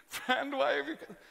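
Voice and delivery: laughing, hammed-up self-pity. Shown as a demonstration. Jesus voiced as pitying himself. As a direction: whine it with mock self-pity. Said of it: "Was it one of self-pity".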